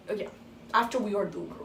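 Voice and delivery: usual speaking voice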